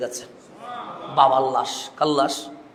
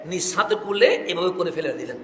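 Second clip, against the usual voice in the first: ordinary delivery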